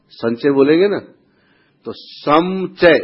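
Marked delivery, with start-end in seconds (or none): 2.0-3.0 सम and चय sounded slowly as two parts; सम-चय split apart. Shown as a demonstration. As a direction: pull संचय apart into सम plus चय, louder. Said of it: "संचय है वो". (सम और चय को अलग करके धीमा उच्चारण)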